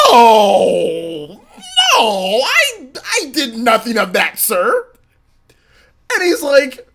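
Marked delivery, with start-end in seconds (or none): 0.1-4.9 laughing denial. Retold or imitated. Frantic denial to principal.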